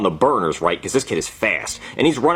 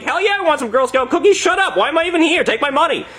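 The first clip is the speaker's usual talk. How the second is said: high, impatient excitement; eager outburst; 14 semitones higher than usual. Retold or imitated. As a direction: blurt it out high, eager to buy. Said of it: "And I'm like".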